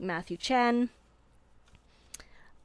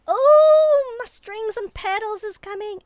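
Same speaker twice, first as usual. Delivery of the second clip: excited squeal; 13 dB louder, slower than usual. Real, not acted. Delighted at a viewer arriving.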